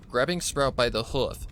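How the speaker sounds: ordinary delivery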